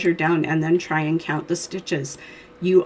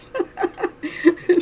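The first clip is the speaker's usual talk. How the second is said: sudden high laugh, falling away; high burst of laughter; 13 semitones higher than usual. Real, not acted. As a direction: laugh at yourself, high and bright. Laughs at her own cold.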